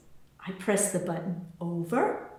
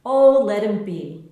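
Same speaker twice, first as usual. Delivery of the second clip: louder, easygoing brush-off; fond neighbour, loud and easy; indulgent; 9 dB louder than usual. A narrator's reading. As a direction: wave the worry away, loudly. Anna indulging the child.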